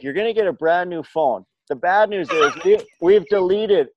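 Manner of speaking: can barely get the clerk's words out for laughing; amused retelling of a store clerk; laughing through quote. Retold or imitated. Retelling Apple guy's bad news.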